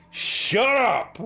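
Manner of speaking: loud, high furious shout; yell. Acted. Two women turning on Salazar to silence Salazar, furious yell. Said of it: "both in unison yell".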